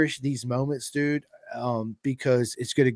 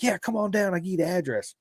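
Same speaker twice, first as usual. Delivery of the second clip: lady on phone; 9 semitones higher than usual. Retold or imitated.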